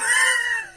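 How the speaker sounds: high squeal of a laugh. Real, not acted. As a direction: burst into a squeaky giggle. Laughs at own joke.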